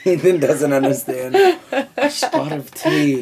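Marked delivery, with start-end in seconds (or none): 2.0-3.2 slow, very high mock British; mock British accent. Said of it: "And now he's British".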